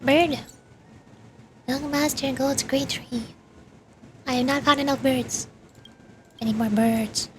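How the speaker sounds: ordinary talk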